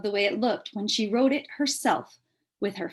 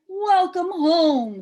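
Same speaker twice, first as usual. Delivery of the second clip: high, slow, loving greeting; warm welcome; 9 semitones higher, 8 dB louder, much slower than usual. Acted. Mother greeting daughter home, slow and loving. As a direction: welcome her home tenderly. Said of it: "said her father".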